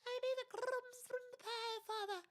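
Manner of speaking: high, hammed-up posh British voice; silly overdone British accent demanding pie crumbs; exaggerated British accent. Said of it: "if someone was like".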